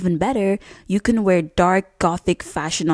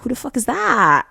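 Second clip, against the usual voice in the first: high-pitched, acted awe at a stranger; impressed amazement; 7 semitones higher than usual. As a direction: play a bystander stunned with admiration. Acting out impressed onlookers.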